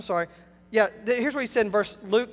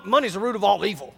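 quick, low throwaway misquote with no weight on "money's"; imitating people quoting it wrong; misquoting the Bible; 6 semitones lower, faster than usual. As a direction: toss it off like a careless quoter. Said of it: "they quote the Bible wrong".

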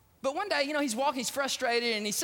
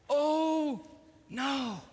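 drawn-out, higher oh no; dismayed; 5 semitones higher than usual. Acted. Johnny sees the dead duck, dismayed. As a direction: let dismay sink in on each word.

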